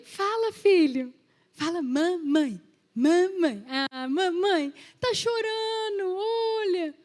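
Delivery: put-on high, slow cooing baby talk; sweet baby-talk mother. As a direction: coo to the baby like a fond mother. Mothers coaxing a crying baby.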